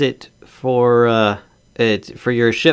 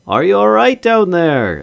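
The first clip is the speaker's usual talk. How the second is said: higher and louder, calling down; 9 semitones higher, 5 dB louder than usual. Acted. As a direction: shout it down from above. Calling down to survivors.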